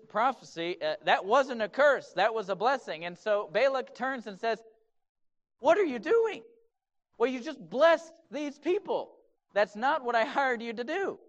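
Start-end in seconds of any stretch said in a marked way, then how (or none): 5.6-11.2 high, raised voice of a cheated employer; hammed-up indignant complaint; displeased outrage. Balak confronts the prophet he hired for blessing, outraged. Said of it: "he was not pleased".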